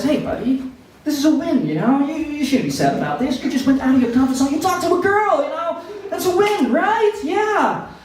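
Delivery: high, chirpy pep-talk voice; encouraging inner voice. Pretend. Comic voicing the consoling voice in his head, high and chirpy. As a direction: cheer yourself up like a hype buddy. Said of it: "a voice in my head, you know, says".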